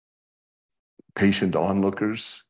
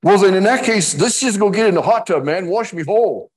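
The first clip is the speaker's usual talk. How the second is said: high, loud, overeager Peter voice; eager Peter; 11 semitones higher, 7 dB louder than usual. Acted. Peter overeager in retold scene.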